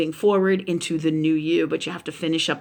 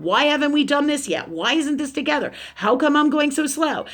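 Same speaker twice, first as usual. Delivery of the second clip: higher-pitched, imitated string of grievances; impatient complaining; 7 semitones higher than usual. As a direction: mimic exasperated clients demanding answers. Voicing impatient people who come to her.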